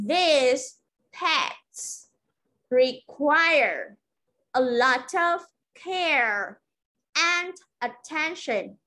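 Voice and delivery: slow model sentence with pauses after each chunk; deliberate teacher's dictation, each chunk falling; phrase-by-phrase drill. Shown as a demonstration. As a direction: say it piece by piece for learners to repeat.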